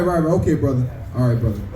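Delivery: normal talking voice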